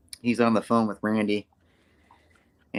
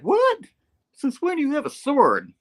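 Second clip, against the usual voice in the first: very high, louder mock squawk; shocked; 4 dB louder than usual. Peter looking at Miles's sword, hammed-up shock. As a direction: leap up in pitch with acted disbelief.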